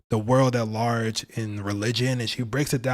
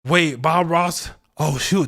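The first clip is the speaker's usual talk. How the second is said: surprised realization; 3 semitones higher, 5 dB louder, slower than usual. Real, not acted. Sudden realisation.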